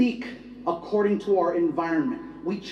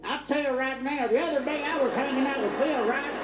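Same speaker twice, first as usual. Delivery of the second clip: higher, exaggerated Southern American accent; mock American drawl; 5 semitones higher, 3 dB quieter than usual. American as the British hear him, mock Southern accent. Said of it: "We sound like this to them".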